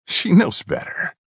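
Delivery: the psycho brother drawls a taunt. Acted. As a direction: taunt slowly. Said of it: "the psycho drawled".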